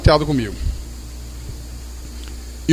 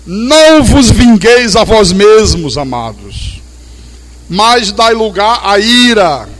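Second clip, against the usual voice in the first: slow, louder proclamation of the verse; slow ringing sermon recitation; solemn scripture reading; 4 semitones higher, 5 dB louder, much slower than usual. Acted. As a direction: lean into each word like preaching. Reads verse in preaching voice.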